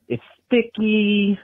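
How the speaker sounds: slow, drawn-out Southern "sticky" and "gators"; Southern accent. Shown as a demonstration. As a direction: savour each word like a bayou local. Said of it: "That's how you say it down here".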